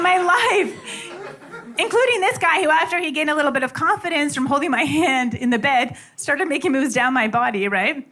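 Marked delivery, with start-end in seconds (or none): none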